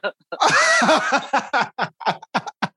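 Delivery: long high-pitched burst of laughter; helpless high laughter; real laughing fit. Real, not acted. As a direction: crack up at your own punchline. Bursts out laughing at own punchline.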